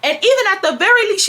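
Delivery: high, quick mother imitation; hammed-up rapid scolding; scolding mom. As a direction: rattle it off as a fed-up mom. Retold mother scolding in quarrel.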